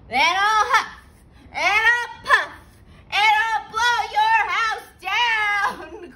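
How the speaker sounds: high, loud, gloating big bad wolf; gleeful wolf. A narrator's reading. Wolf threatening the pig inside, loud and gloating. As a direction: crow it like a greedy wolf sure of his dinner. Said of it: "cried the wolf".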